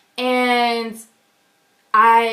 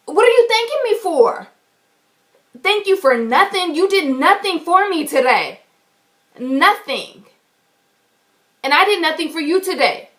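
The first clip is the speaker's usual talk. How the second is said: high, loud confrontation; retelling confronting the teller, high and loud; indignant retort; 6 semitones higher, 4 dB louder than usual. Retold or imitated. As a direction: throw her thanks back at her, higher. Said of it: "I turned around and I said".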